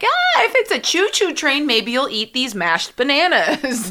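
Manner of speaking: put-on high, slow coaxing voice for a baby; baby talk. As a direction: coax a baby to eat, sing-song. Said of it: "You're like".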